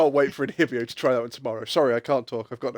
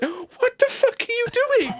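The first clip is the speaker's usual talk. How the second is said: much higher and slow, incredulous; mock baffled outcry; slower than usual. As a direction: stretch it out like a stunned viewer. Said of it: "And it's just like".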